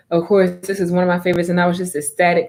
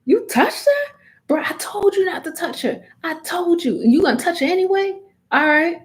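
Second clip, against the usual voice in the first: high, outraged protective hero; Meliodas voice; 10 semitones higher than usual. Retold or imitated. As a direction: squeal it out in mock outrage. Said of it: "Meliodas about to be like".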